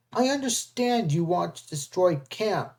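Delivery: ordinary delivery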